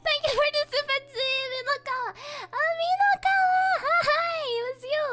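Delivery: high, loud thrilled greeting; excited squealing. Real, not acted. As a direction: squeal the thanks with delight. Excited superchat reaction.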